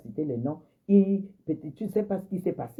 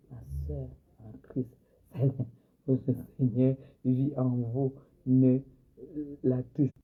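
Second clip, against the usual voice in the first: mock pious voice; 4 semitones lower than usual. Mocking a pious churchgoer.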